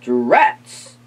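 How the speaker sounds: a low, cross little curse; grumbling vampire. Acted. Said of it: "said the pu-, vampire".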